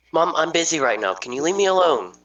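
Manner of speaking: normal talking voice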